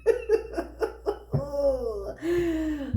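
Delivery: long giggle winding down to a sigh; helpless run of chuckles; real laugh. Real, not acted. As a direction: laugh it out, then let it settle. Laughs at own story.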